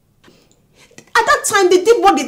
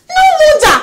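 much higher, loud outcry; shouted realisation; 13 semitones higher, 10 dB louder than usual. Acted. Wife to husband on realising why, shouted realisation. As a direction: cry it out as it clicks.

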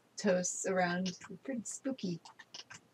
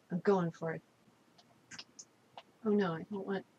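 ordinary talk

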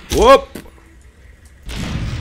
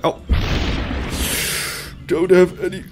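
ordinary delivery

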